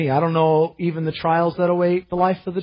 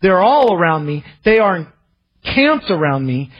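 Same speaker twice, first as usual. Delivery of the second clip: louder, higher plea to God; crying out; 3 semitones higher, 5 dB louder than usual. Acted. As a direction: call out with urgency against enemies. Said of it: "he's crying out".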